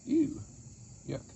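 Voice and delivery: high squeal of disgust at the goo. Real, not acted. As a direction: recoil from the mess.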